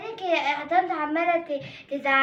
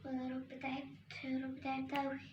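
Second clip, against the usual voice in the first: worn-out prayer; 3 semitones lower, 5 dB quieter than usual. Acted. Tired girl begging God after endless chores, faint and weary. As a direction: barely breathe it out, exhausted. Exhausted girl praying while sweeping.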